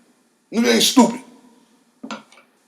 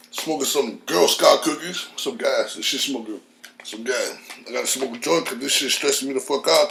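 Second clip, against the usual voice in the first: subdued murmur; 5 semitones lower, 6 dB quieter than usual. Real, not acted. Calms down smoking a joint.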